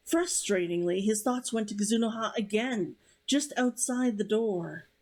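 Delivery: steady, even novel reading; story narration. A narrator's reading. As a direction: read the chapter to listeners.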